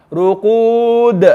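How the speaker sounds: Ruqud with bounced d; long vowel, then echo the d of Ruqud; qalqala on dal. Shown as a demonstration. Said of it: "We wanna read it".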